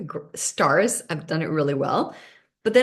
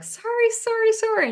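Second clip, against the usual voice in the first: much higher, fluttering apologies; apologetic staff. Retold or imitated. Hotel staff apologize to the speaker, high and flustered. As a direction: play the flustered receptionist saying sorry. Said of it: "And they're like".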